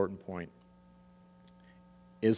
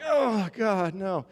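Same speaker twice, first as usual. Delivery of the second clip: mock dismay; 11 semitones higher, 6 dB louder, much slower than usual. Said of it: "And I'm like".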